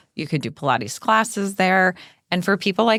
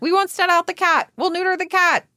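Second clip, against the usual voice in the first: excited; 11 semitones higher than usual. Retold or imitated. Retold own eager reply.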